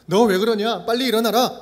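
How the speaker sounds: loud hurried pushing; pressing scold. Pretend. A rushing God pressing Elijah to get up, scolding. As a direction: hustle him up with a sharp scold. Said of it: "막 다그쳐서 막 서두르신".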